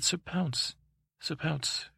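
appealing to the cat. Acted. Child questions the cat.

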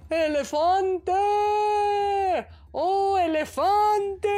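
high, loud, slow call; tortoise calling out. A narrator's reading. Hidden tortoise shouts teasingly to the elephant. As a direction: shout across the jungle, teasing. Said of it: "la tortuga gritó".

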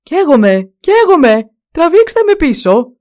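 loud, high cries of alarm; shouting. A narrator's reading. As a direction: yell for help as if burning. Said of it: "άρχισε να φωνάζει".

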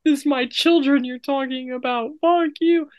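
high indignant cartoon voice; offended little mascot squeak; squeaky outraged voice. Chicky voice defends nuggets.

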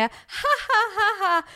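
high, spelt-out "ha ha ha" laugh; mock laugh, pitched well up; demonstrated laugh. Shown as a demonstration. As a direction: act out a big laugh on each ha. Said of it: "absolut skratt som är".

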